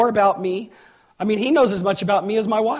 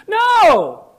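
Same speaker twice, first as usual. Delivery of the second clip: high, loud shouted no; sudden full-voiced rebuttal; emphatic denial; 11 semitones higher, 9 dB louder than usual. Real, not acted. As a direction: burst out to knock the idea down. Preacher rejects mocked worry.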